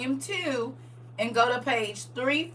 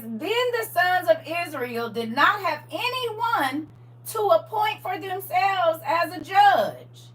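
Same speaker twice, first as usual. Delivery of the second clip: slow, measured reading aloud; scripture reading; 3 semitones higher, slower than usual. Acted.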